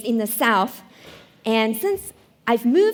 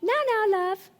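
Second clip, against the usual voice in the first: higher, sing-out mock reply; acted reply, pitched up; wife's stalling reply; 8 semitones higher than usual. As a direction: put off a waiting husband. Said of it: "I would say something like".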